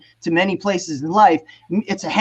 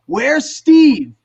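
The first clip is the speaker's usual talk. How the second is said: higher, louder mimicked alarm; bandmates' alarmed question; 6 semitones higher, 5 dB louder than usual. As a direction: call out for the missing friend. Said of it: "And they go".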